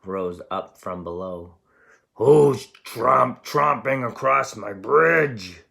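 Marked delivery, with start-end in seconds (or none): 2.1-5.6 loud, slow troll roar. Acted. Troll challenging the big goat, loud and slow. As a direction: roar it slowly from under the bridge. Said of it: "A roar rose".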